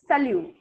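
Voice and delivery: স্যালিউ said sa-liu with a clear liu ending; French salut. Shown as a demonstration. Teacher models স্যালিউ, students repeat, clear demo. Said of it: "বলেন".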